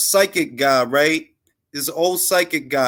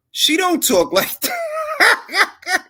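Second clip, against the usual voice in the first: very high, slow squeal; mock falsetto disbelief; much slower than usual. Mocking a fake accent, very high squeal.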